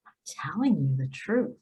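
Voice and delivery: soft, hushed whisper; whispered assurance. Pretend. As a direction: lean in and whisper the promise. God reminding Lori gently.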